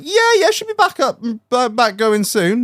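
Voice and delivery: high put-on voice of the van driver; lightly hammed-up lorry driver; imitating driver. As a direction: play the caught-out driver, high and evasive. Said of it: "He was like".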